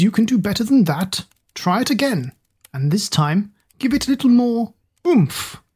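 big, bossy teacher voice; robust music teacher. A narrator's reading. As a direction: order the pupil to sing harder. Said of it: "ordered the robust music teacher".

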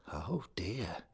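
hushed dismay. A narrator's reading. Bill dismayed.

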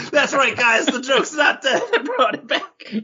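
laughing. Real, not acted. Gloating over a revived joke.

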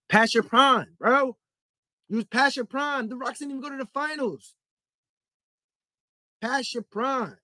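high-pitched, worked-up insistence; heated exasperation. Real, not acted. Host telling fans the Rockets were past prime, exasperated.

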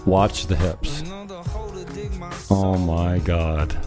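ordinary talk

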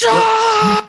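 high frustrated yell; exasperated scream. Retold or imitated. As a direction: let out a shrill exasperated cry. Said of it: "And I'm like".

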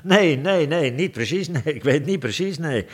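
high, flustered stammer with many false starts; stuttering. Acted. The king, caught out, stammers his answer. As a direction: trip over every word like a cornered king. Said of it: "stotterde hij".

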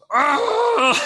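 high, loud frustrated howl; raging cry. Retold or imitated. Finding the restaurant closed, a loud high raging cry. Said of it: "so I'm raging".

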